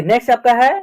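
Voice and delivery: normal talking voice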